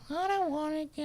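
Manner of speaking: put-on high, whining child refusing to leave; whiny kid. Parent imitating kids at the door, high whine. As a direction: play the kid who won't go out the door. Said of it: "they're just".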